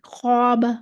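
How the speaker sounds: "ख्वाबा" with a heavy, dark long alif; मोटा अलिफ. Shown as a demonstration. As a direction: make the first vowel of "ख्वाबा" full and dark. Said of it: "मोटा पढ़ेंगे". ("ख्वाबा" का अलिफ मोटा करके पढ़ें)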